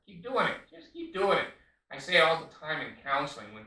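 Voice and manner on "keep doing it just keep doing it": high, louder taunting "keep doing it"; sarcastic dare. Acted. Counselor taunting people who like shitstorms, sarcastic. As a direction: egg them on with mock encouragement.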